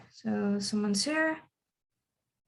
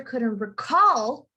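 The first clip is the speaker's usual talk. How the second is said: "recall" as noun, weight on "RE-"; stress on first syllable; much slower than usual. Shown as a demonstration. Teacher rereads the example sentence, "recall" stressed on RE-. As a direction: hit the start of "recall". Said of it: "you want to emphasize the end".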